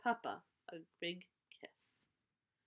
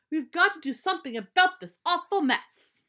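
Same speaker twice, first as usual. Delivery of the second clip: much higher and louder, fed-up shout; group outcry; 10 semitones higher, 13 dB louder than usual. Acted. The family at the meeting all shout about the mess. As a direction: cry it out together in exasperation.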